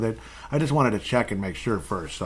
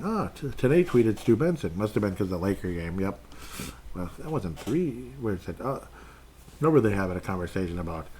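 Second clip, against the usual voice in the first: ordinary talk, nothing marked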